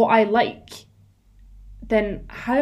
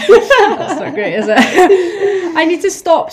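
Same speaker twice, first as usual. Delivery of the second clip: laughing through the words, louder and slower; 8 semitones higher, 11 dB louder, much slower than usual. Real, not acted. Cohost admitting she censors herself, laughing. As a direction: giggle it out, embarrassed at yourself. Laughing at own cringe admission.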